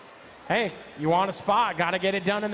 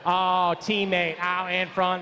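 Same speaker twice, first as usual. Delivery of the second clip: ordinary delivery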